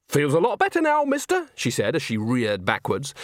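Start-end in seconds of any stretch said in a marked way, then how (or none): 0.1-1.5 high, mocking and pleased; gloating taunt. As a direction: taunt him cheerfully. Nanny taunting after kneeing him.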